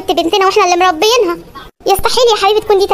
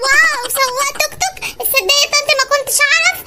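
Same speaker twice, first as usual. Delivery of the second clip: softer, higher fake amazement, dripping with sarcasm; hushed, higher put-on wonder; sarcastic mock awe; 6 semitones higher, 4 dB quieter than usual. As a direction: pretend to be impressed while mocking him. Bride mocks tuk-tuk driver groom.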